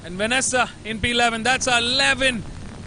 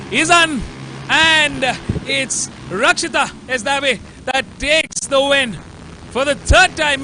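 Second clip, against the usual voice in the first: excited race call; 2 semitones higher, 4 dB louder than usual. Real, not acted. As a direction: call the win with rising excitement. Race finish called live.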